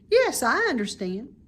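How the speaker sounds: put-on bright childlike pig, fond of the barn; high, eager young pig; young pig Wilbur. Wilbur voiced higher.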